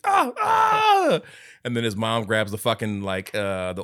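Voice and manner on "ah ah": high, loud burning-arm scream; mock pained scream. Acting out dad with arm on fire, screaming. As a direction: cry out as if on fire. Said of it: "gets his arm caught on fire".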